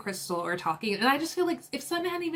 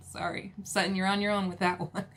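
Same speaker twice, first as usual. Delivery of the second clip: ordinary talk, nothing marked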